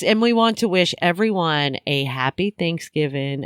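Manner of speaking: ordinary delivery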